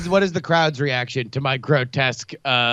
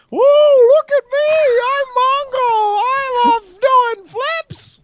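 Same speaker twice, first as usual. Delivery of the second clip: mocking puppet voice in shrieking falsetto; very high, loud and drawn-out, puppeteering a victim in mockery; Mongo; 7 dB louder, slower than usual. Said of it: "I pretend like".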